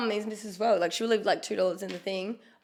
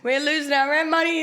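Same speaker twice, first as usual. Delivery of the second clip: raised, higher mock complaint; mock yelling; 7 semitones higher, 4 dB louder than usual. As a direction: yell it in mock alarm, pitched up. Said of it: "we yell at his missus".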